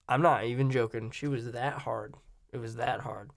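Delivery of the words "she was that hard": ordinary talk, nothing marked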